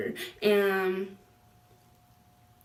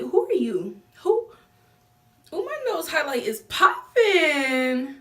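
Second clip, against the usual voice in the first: high, slow sassy clapback; mock-offended sassy comeback; sassy retort; 7 semitones higher, 5 dB louder, slower than usual. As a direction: drag it out like a diva.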